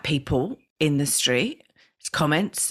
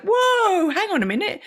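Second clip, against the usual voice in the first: pitched way up in retold alarm; startled mum; 10 semitones higher than usual. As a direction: catch yourself in mock shock, high. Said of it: "'Cause I was like".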